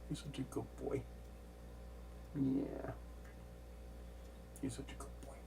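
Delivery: hushed cooing to a pet; talking to a cat. Real, not acted. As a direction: whisper sweet praise to the cat.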